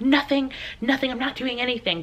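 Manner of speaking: acted-out hurt "it's nothing" voice; defensive denial. Girlfriend hurt over no proposal, defensively denying to boyfriend.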